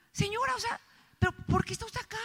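pregunta aguda y suave, imitando llanto; llorosa. Acted. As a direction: pregunta como aguantando las lágrimas. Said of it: "empecé a llorar". (llanto actuado, voz aguda)

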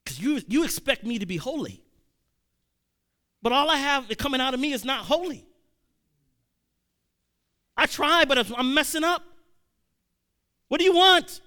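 exasperated yelling up at heaven; upset outburst. Retold or imitated. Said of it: "I was upset".

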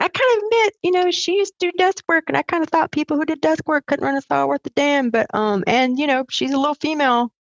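a rough try at a Western Maryland man admitting he was wrong; Western Maryland accent. Retold or imitated. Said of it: "I can't do the accent well".

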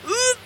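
imitated gagging noise; short disgusted heave; mock retch. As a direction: retch as if about to vomit. Retells retching at the wallaby.

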